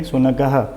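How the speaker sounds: शुनकः ending in a clear "ha"; teacher's model of शुनकः, final visarga voiced "ha"; sounded visarga. Shown as a demonstration. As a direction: pronounce शुनकः as "shunaka-ha". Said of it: "ह की ध्वनि निकलेगी जब भी उच्चारण करोगे".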